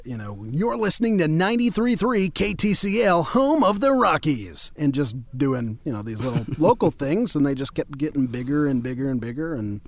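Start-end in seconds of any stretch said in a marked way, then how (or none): 0.5-4.7 radio announcer. Shown as a demonstration. Demoing a local radio ad, in an announcer voice. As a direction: sell it like a local DJ. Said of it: "local radio spots".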